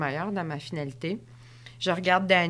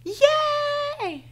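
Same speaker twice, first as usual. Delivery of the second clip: high cheering yell, then slower; excited cheer; 11 semitones higher, much slower than usual. Retold or imitated. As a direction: cheer her on from a terrace. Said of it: "une femme sort".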